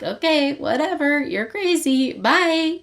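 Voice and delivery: high, singsong mock dismissal; dismissive brush-off. Rave to confronting Vea, dismissive. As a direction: wave them off in a high voice.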